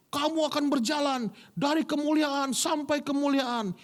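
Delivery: high, drawn-out prophetic proclamation; imitated shouting preacher. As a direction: declaim like a revival preacher. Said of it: "hamba Tuhannya teriak". (menirukan seruan nubuat hamba Tuhan)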